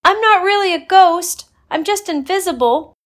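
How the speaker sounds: high loud call; calling after them. Acted. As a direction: call out to them running away. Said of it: "Emma called after them".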